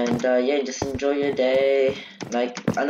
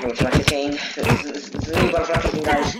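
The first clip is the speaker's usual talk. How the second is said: normal talking voice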